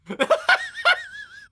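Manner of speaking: high shrieking laugh; high burst of laughter. Real, not acted. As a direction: laugh out loud, high and sudden. Laughing at absurd plot.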